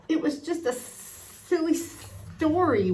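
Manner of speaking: ordinary delivery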